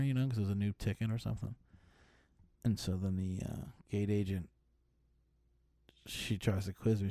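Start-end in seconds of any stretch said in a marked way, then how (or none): none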